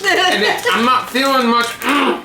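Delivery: high, strained mock groan; exaggerated pained mm. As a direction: squeak out a pained hum. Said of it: "They're all like".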